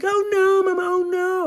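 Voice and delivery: put-on high, loud whining child; whiny little boy. A grown man voicing a whining boy at the gate. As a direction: wail like a small kid refusing.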